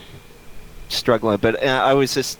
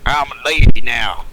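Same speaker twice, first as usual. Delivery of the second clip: louder put-on lady, falling; loud hammy lady voice; 3 semitones lower, 8 dB louder than usual. Repeat louder, different voice.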